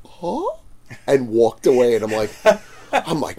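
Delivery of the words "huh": small, high, soft mimicked reply; customer's reaction. Customer after being yelled at, mimicked small and high. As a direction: squeak it softly as the taken-aback customer. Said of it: "And he's like".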